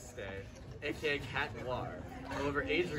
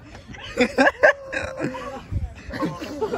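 long high ringing tone, much higher; high-pitched ringing noise; 12 dB louder than usual. Shown as a demonstration. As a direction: imitate ear ringing with a high held note. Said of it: "my impression of a high-pitched ringing noise".

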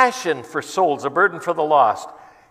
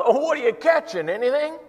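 higher, teasing jeer; mocking crowd; 7 semitones higher than usual. Crowd teasing the man fishing on the ladder, mocking. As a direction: poke fun at the fisherman. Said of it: "then it went wild".

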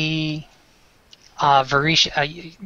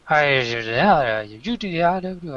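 fake foreign language; 3 semitones higher than usual. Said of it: "That's terrible. Like, fake language".

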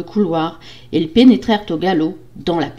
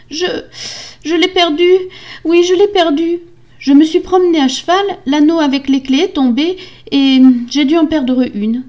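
high, loud, hesitant then insistent; frightened excuse; 10 semitones higher, 6 dB louder than usual. Acted. Wife lying about the lost key, frightened protest. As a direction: plead your excuse anxiously. Wife nervously lies to husband.

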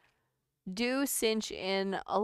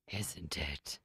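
whispered punchline; 11 dB quieter than usual. Acted. Retelling the dinosaur joke, whispered punchline. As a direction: whisper it, low. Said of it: "dinosaur punchline retry".

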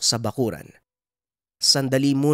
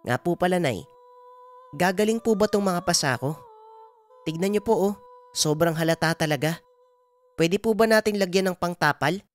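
put-on higher-pitched young boy asking his mother; Juanito, questioning child; 9 semitones higher than usual. Son asks mother about his bruises, childlike and concerned. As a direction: ask like a concerned little son, voice raised in pitch.

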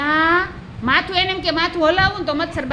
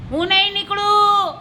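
defiant little mosquito; 6 semitones higher than usual. Acted. Mosquito refuses from the ear.

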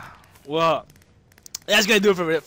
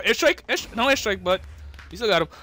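ordinary delivery

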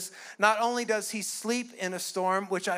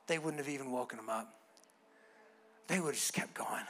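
whisper; 10 semitones lower, 10 dB quieter, faster than usual. Real, not acted. Hushed aside about disciples.

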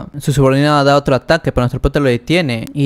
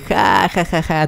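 higher, drawn-out fake ha-ha-ha; acted villain laugh; 4 semitones higher, slower than usual. Señor Tigre laughs at a trivial fight, acted laugh. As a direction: open high on a hammed-up laugh, then settle. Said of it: "se comienza a reír".

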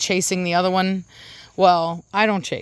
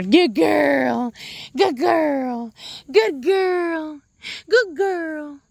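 praising the dog; 7 semitones higher, much slower than usual. Real, not acted. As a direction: coo praise at your dog. Praising dog after catching her.

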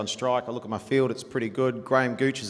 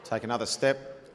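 ordinary talk, nothing marked

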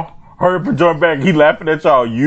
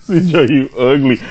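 ordinary talk

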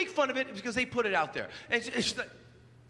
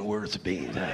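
much lower cowboy drawl; cowboy in the audience asking who said it; slower than usual. Retold or imitated. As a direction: drop low like a gruff cowboy. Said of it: "some guy with a cowboy hat".

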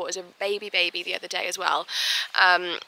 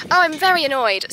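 louder, mock-cross declaration; mock annoyed; 5 semitones higher, 6 dB louder than usual. Shown as a demonstration. Rider jokingly complaining about herself, mock-annoyed. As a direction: announce being annoyed, half joking. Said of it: "not very annoyed".

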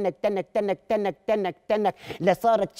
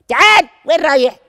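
high, loud call; 7 semitones higher, 12 dB louder than usual. Acted. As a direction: shout him back. Father calling son again.

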